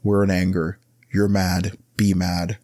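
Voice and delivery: ordinary talk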